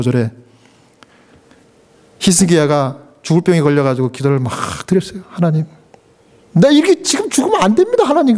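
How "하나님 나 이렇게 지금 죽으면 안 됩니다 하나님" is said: higher, pleading prayer with a touch of tears; tearful plea. Acted. Hezekiah, deathly ill, begging God with mock tears. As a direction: beg God not to let him die, near tears. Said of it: "그러면서 막 울었어".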